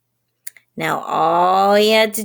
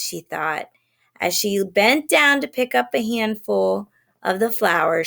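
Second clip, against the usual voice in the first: ordinary delivery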